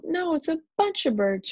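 high-pitched excited call; shouted correction. A narrator's reading. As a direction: pipe up over the others. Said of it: "shouted Alexa".

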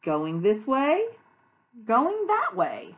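loud, slow, lilting storybook reading; read-aloud to children. A narrator's reading. Teacher shows children the buses' directions, slow sing-song read-aloud. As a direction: stretch each phrase for little listeners.

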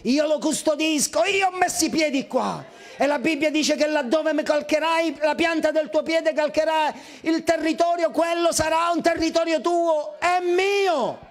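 ringing, rising preacher's proclamation; preaching at full force. Acted. Preacher to congregation, claiming the land, proclaiming.

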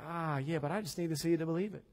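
doubter. Retold or imitated. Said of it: "who have Thomas temperament".

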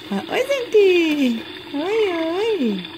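put-on speaking for the baby, high and cooing; high baby voice for the daughter. Mother speaks for baby greeting viewers, high cooing baby voice. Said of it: "ela tá, fala oi".

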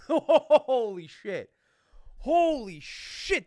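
stunned laughing outburst. Real, not acted. Reacting to a perfect guess, real stunned laughing shout. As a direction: let it burst out with a laugh.

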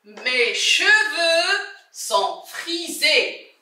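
"Mes cheveux sont frisés" said slowly, word by word; teacher dictating "Mes cheveux sont frisés" slowly; slow dictation. Shown as a demonstration. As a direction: spell it out slowly for the class. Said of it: "teacher models the sentence slowly".

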